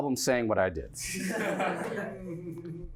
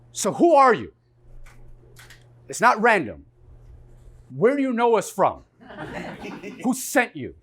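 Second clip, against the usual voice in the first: loud, higher, accusing questions, a hint of laughter; mock cop yelling; 5 semitones higher, 8 dB louder than usual. Cop yelling at the film crew, loud and accusing. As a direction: bark the questions at them like an angry cop.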